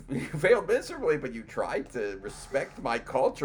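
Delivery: high, loud gushing mock guest; excited guest. Guest reacting to the sung anthem, gushing high and loud. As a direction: gush like the overjoyed guest. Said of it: "he was like".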